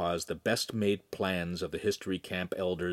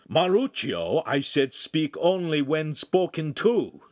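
loud, slow, higher rebuke; gruff; 8 semitones higher, 5 dB louder, slower than usual. A narrator's reading. Master rebukes Lincoln for butting in, gruffly. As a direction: scold firmly and deliberately. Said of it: "the master said gruffly".

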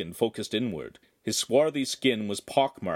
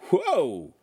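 startled whoa; 7 semitones higher, 3 dB louder than usual. Acted. Sudden growth in his temple.

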